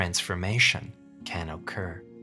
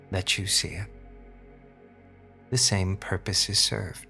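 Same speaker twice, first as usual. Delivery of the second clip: sultry narrator. Acted. Hypnosis session, slow guided relaxation.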